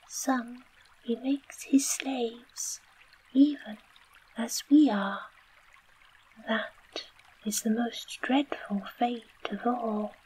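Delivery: high little mouse voice, whispered; little field mouse. A narrator's reading. Field mouse button to Dorothy, high fretful whisper. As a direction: squeak it up high like a tiny mouse. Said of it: "added the field mouse".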